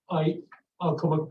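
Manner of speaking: ordinary delivery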